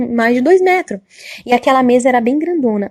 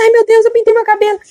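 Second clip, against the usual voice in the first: put-on high, loud, pleading old lady voice; a frightened old woman confessing; frantic old woman; 11 semitones higher, 4 dB louder than usual. Old woman confessing to the angel, high and pleading.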